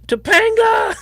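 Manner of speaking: high, loud shout of the name. Pretend. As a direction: yell it out with glee. Calling out gleefully.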